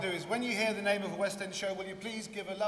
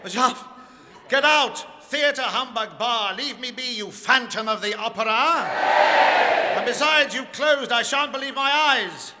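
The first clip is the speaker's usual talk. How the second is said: loud, high, shouted miser; angry Scrooge; 4 semitones higher, 11 dB louder than usual. Acted. Scrooge ordering the ghost out, shouting angrily.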